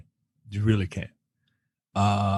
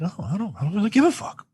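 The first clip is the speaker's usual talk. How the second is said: imitating indifference; 9 semitones higher, 4 dB quieter, faster than usual.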